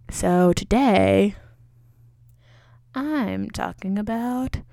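drawn-out, dull host voice; slow boring podcaster. Retold or imitated. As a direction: drag each word out like a boring host. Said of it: "you're just like".

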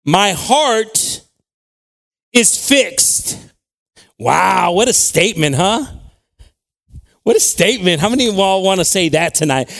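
loud, high, driving sermon voice; preaching at full force. Acted. Pastor preaching about David to the church, loud. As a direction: preach it hard to the back row.

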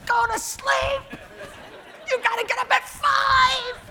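high mock yell to wake her; mock shout. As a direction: shout up high, half laughing. Yelling at sleeping wife.

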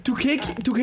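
high, laughing and fast; excited laughing agreement. Real, not acted. Agreeing with the co-host's guess, high and laughing.

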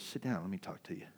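gentle invitation. Retold or imitated. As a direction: invite them kindly to sit and listen. Jesus speaking gently.

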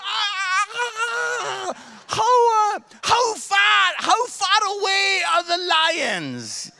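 loud, high alarmed shouting; frightened tourist shouting the question; panicked question. Retold or imitated. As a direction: blurt it out in a panic. Panicked tourists asking about lions.

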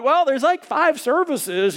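awkward explaining. Retold or imitated. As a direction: fumble for an excuse.

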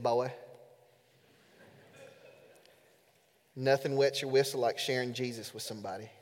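lightly imitated Alabamian accent, low and quiet. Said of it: "in his thick Alabamian accent".

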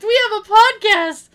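high, loud imitation of an insistent friend; loud insistence. Friend points to his shirt for the librarian, loudly insisting. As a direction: belt it out as if not understood. Repeats it after she says "What?.

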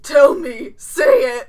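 lightly put-on character voice; a hint of a gruff character. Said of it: "my weird Barry Blue Jeans Clark hybrid".

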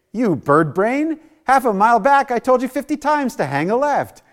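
high, loud mock tirade; hammed-up nagging navigator, voice shooting up; exasperated scolding. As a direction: berate a hopeless driver at the top of your voice. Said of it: "She doesn't say".

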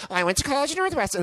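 mock her chatty small talk; mock girl voice. Mocking the girl's chatter while he eats, high fast voice. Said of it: "she's like".

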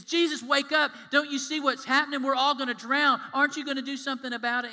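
loud, high frantic yelling; panicked shouting. Retold or imitated. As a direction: scream for help over the storm. Panicked disciples in storm.

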